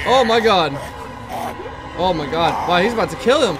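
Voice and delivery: loud, high stunned cries; shocked exclamations. Real, not acted. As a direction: gasp out in shock. Watching a brutal kill.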